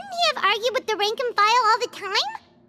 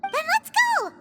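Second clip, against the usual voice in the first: bright, much higher shout of let's go; rally everyone to head out now; excited cheer to get moving; eager call; 10 semitones higher than usual. Acted.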